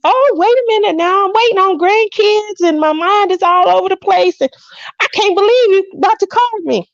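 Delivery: high-pitched mock fluster; flustered excitement. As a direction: play it all worked up, pitch way up. Acts out her flustered excited reply.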